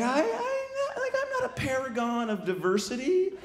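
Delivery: very high, hesitant squeaky objection; awkward squeak. Acted. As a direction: squeak it out softly, stalling. Awkward reply to racist remark.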